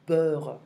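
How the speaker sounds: beurre said loud with a rounded eu and a French r; careful beurre. Shown as a demonstration. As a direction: stress the sound of beurre. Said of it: "be careful of that".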